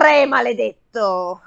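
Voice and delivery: slow, weighty hero's roar. Acted. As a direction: roar out the curse slowly. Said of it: "ruggì". (ruggito lento e solenne)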